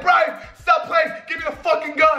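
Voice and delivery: very high, urgent retold yell; exasperated mock shout. Telling Brian to stop playing, exasperated mock shouting. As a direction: shout it like a high-pitched desperate order. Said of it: "I said".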